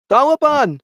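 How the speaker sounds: mock excited yell. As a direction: yell the misheard name gleefully. Kid mishearing name loudly.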